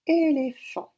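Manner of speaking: "éléphant" plus fort et plus haut, syllabes détachées; mot appuyé. Shown as a demonstration. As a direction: projeter "éléphant" en appuyant le début. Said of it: "nous entendons le son". («éléphant» articulé fort, voix montée)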